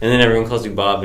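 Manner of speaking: ordinary talk, nothing marked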